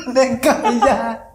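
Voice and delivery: high-pitched laughter through the words; can barely get it out for laughing. Real, not acted. Telling friend the absurd boss story, breaking up laughing.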